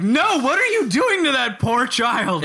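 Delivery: high-pitched, drawn-out mock horror; mock outraged scolding. Player interrupts the wizard over the poor child, mock outraged. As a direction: scold a villain in hammed-up dismay.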